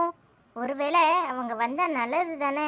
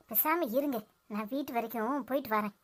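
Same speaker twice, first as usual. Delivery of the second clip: hurt quiet withdrawal; 6 semitones lower, 9 dB quieter than usual. Acted.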